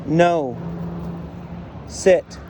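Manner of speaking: patient, insistent orders with long pauses; firm correction. Real, not acted. Trainer keeps correcting Frida until she sits, firm commands.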